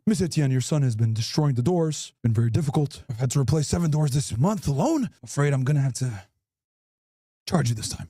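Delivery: low, formal principal voice; deep principal voice. Acted. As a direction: speak low and stern like a principal.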